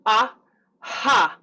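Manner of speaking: "Aha" in two separate bursts, clicky then airy; sticky then breathy. Shown as a demonstration. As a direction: pop the "A", pause, blow out the "ha". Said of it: "one sticky and then one".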